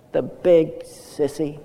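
put-on slow, trembling small boy; shaky little voice. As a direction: pout it out like a sulking kid. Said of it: "this shaky little voice".

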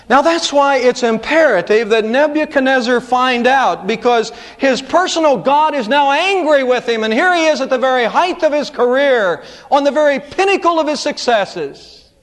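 high, ringing pulpit climax; preaching. Acted. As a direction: build it up for the church.

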